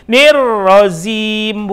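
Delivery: "Nirrozim" slow, Z in place of J; wrong pronunciation. Shown as a demonstration. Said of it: "bukan rozim".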